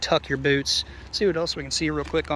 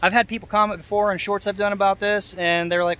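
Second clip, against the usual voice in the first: normal talking voice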